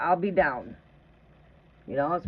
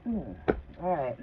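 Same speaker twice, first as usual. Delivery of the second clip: ordinary delivery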